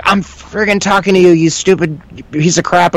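high-pitched, exasperated mock rant; imitated angry yelling. Cop to prisoner ignoring him, mock yelling. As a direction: play the cop losing it, without real volume. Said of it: "this guy's yelling and freaking out".

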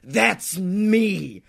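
ordinary talk, nothing marked

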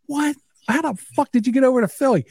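high, fast disbelief; incredulous. Retold or imitated. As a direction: woken at two and can't believe it. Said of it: "my Uncle Tony's like".

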